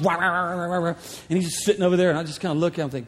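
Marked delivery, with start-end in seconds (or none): 0.0-0.9 a lightly voiced truck roar; imitated engine rev. Truck revving beside the preacher, mock engine noise. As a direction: rev it like a diesel. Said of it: "just making a lot of noise".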